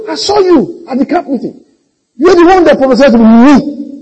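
high-pitched imitation of a girl; accusing girl. Girl recognising Peter from camp meeting, accusing. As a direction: loudly point him out, mock accusation.

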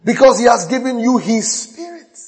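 loud, high preacher's proclamation; preaching at full force. Acted. Pastor proclaiming to his church, loud and high. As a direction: preach it out to the congregation.

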